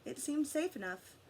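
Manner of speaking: usual speaking voice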